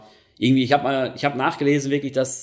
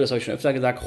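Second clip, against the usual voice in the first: normal talking voice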